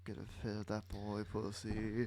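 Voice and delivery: low, hushed put-on voice; Dirk's inner voice. Dirk's psyche scheming to itself, low and hushed. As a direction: drop low and quiet, musing privately. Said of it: "psyche that's like".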